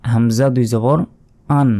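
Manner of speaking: হামজা দুই জবর spelled out with a rise, then আন said short; recited spelling. Shown as a demonstration. Teacher to students learning Quran letters, spelling syllables aloud. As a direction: spell it out slowly, then land on আন. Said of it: "তাহলে".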